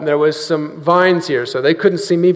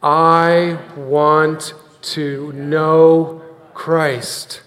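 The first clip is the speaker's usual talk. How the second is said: very slow, measured, leading a congregation; word-by-word recitation; 3 semitones lower, much slower than usual. Shown as a demonstration. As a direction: draw out each word so others can join in. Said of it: "Say that with me".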